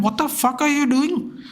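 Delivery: high, exasperated retold yell; angry outburst. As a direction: throw it at the screen in disbelief. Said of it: "I actually got mad".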